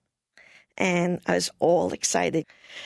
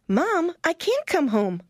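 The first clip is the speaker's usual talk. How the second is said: higher, earnest protest; pleading with mom; 10 semitones higher than usual. Retold or imitated. Young missionary refusing her mother's call, higher and earnest. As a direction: insist to your mother, higher. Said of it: "And I said".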